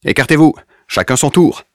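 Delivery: high, loud commanding officer; barked order. A narrator's reading. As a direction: order the soldiers back. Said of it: "ordonne-t-il".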